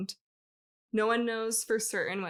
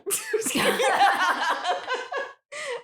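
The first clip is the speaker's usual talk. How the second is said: high laugh; 13 semitones higher, 7 dB louder than usual. Real, not acted. Long laugh.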